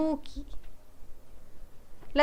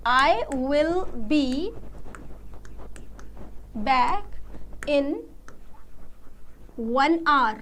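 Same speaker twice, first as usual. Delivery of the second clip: extremely slow, long pauses between words; very slow demo; 5 dB quieter, much slower than usual. Shown as a demonstration. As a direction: lay out each word separately for the class. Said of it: "जब मैं ऐसे बोलती हूँ". (बहुत धीरे, हर शब्द अलग करके)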